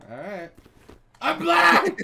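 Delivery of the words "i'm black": high shrieking yell with laughter; screaming laugh. Acted. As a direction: scream it and cackle.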